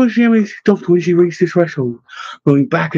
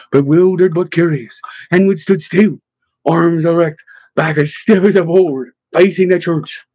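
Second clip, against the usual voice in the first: usual speaking voice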